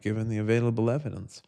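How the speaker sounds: ordinary talk, nothing marked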